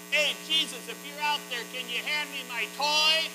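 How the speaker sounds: high, loud call out the back door; yelling out. Retold or imitated. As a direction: shout it across the yard like a small boy. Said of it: "and yelled out".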